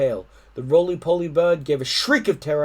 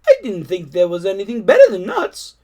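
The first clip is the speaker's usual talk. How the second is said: whiny monkey; 5 semitones higher, 8 dB louder than usual. Acted. Monkey voice in story.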